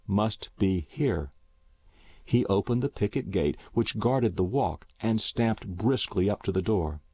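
ordinary delivery, nothing marked

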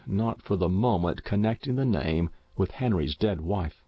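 usual speaking voice

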